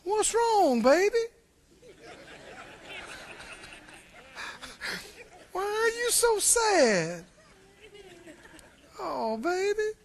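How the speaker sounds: high, sugary coaxing of a sulking husband; coaxing wife. Retold or imitated. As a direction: play the wife cooing over her man. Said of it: "she said to him".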